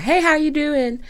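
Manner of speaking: lightly voiced cheerful Southern greeting; friendly greeting. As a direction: imitate an overly friendly coworker. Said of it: "he was extremely friendly".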